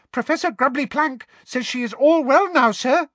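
high squeaky house elf. Acted. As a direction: chirp it brightly. Elf voice continues.

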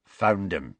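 ordinary delivery

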